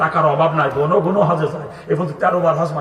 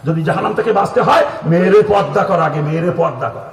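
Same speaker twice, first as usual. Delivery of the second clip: loud, raised preaching command; stern warning; 5 dB louder than usual. Real, not acted. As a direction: drive the warning home forcefully.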